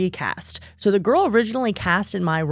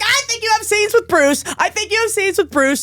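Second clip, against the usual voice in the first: high hushed excited mom; high awed mock mom; imitating excited mom; 11 semitones higher than usual. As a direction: pitch up, awestruck. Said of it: "She's like".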